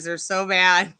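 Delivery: normal talking voice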